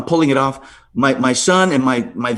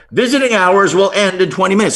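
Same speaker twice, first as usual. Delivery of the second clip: higher PA announcer voice; loudspeaker announcement; 5 semitones higher than usual. Retold or imitated. Hospital speaker repeating over his call, announcer voice. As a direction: mimic the hospital speaker again.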